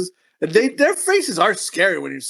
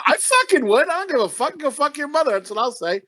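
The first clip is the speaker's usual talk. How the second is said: defiant retort; 8 semitones higher than usual. Pretend.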